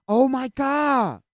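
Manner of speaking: high, loud yell of frustration; exasperated shout. Real, not acted. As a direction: build to a drawn-out, fed-up shout. Same meme again, outburst.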